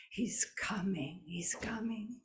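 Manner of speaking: breathy urgent whisper. A narrator's reading. Healer to the family, whispering he's coming. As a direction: whisper it as news arriving. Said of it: "And old Duck Egg says".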